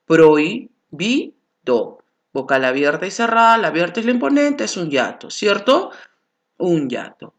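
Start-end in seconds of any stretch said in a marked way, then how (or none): 0.1-2.0 "prohibido" drawn out very slowly and clearly; slow example word. Shown as a demonstration. Teacher slowly sounding out "prohibido" for students. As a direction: spell out "prohibido" by ear, syllable by syllable. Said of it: "Por ejemplo".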